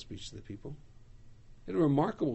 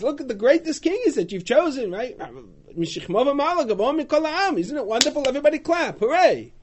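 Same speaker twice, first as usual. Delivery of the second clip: much higher, louder sarcastic cheer; mock celebration; 11 semitones higher, 9 dB louder than usual. Shmuel sarcastically hailing the people's chosen king, high and loud. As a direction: cheer with biting irony.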